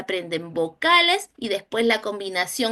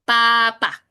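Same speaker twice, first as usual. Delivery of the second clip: Papa, Pala, Pelo called out louder; loud classroom word reading; reading words aloud; 6 dB louder, much slower than usual. Shown as a demonstration. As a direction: announce each word like a reading card. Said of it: "¿Qué dice junto?".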